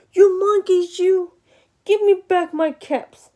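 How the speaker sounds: loud, high-pitched angry demand. A narrator's reading. Peddler stamping his foot at monkeys, very angry. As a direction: raise voice and pitch, scolding hard. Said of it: "Now he was very quite angry".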